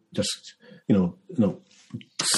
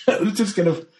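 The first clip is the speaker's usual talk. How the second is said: ordinary talk, nothing marked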